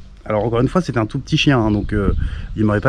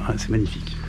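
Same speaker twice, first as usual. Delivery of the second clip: softer, breathed-out admiration; hushed awe; 3 semitones lower, 9 dB quieter than usual. Real, not acted. Standing before the abbey, murmuring his awe to viewers. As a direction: let the wonder drop almost to a murmur. Repeats admiration more softly.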